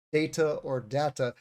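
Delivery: "data" as DAY-tuh, then as DAT-uh; contrasting pronunciations. Shown as a demonstration. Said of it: "Generally, British English".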